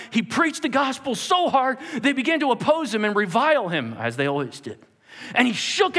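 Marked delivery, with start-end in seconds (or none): none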